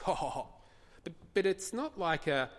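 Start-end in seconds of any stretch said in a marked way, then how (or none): 0.0-0.5 short, spoken "ha, ha, ha" chuckle; mock divine chuckle. God looking down from heaven at the nations, mock chuckling. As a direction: act out a brief chuckle. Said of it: "and he's chuckling".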